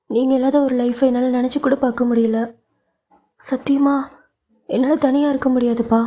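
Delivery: cling to him and beg, holding back sobs; pleading, about to sob. Acted. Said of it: "என்று அவள் தேம்பி அழ ஆரம்பித்தாள்".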